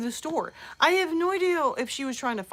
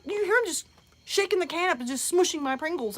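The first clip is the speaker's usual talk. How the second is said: ordinary talk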